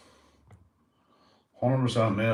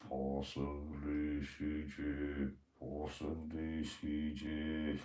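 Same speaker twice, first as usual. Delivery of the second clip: much lower, much softer, very slow put-on voice; slow pet voice; 6 semitones lower, 13 dB quieter, much slower than usual. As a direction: drawl it out low and quiet like a sleepy pet. Voices the dog's slow replies.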